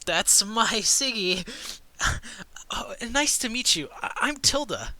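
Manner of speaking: put-on flustered noble girl meeting the party; flustered young woman. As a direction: voice a bashful girl caught out. GM voices Tilda, high and flustered.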